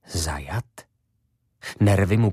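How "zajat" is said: breathless whisper of sudden dread; whispered thought. Acted. Ivo realising he is locked in, whispering. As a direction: breathe it out, afraid to say it aloud.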